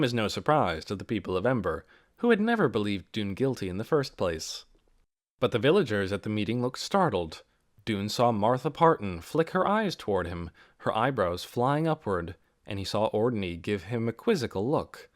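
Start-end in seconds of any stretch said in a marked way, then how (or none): none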